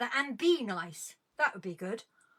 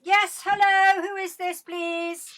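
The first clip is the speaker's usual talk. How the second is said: higher and louder, a sing-song telephone hello; hammed-up phone greeting; 6 semitones higher, 7 dB louder, much slower than usual. Host greeting a caller on air with a hammed-up phone hello. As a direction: put on your best answering-the-phone voice.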